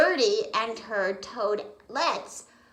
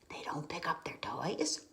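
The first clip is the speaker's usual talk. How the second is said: whisper; 5 semitones lower, 10 dB quieter than usual. Real, not acted. Aside to child listener.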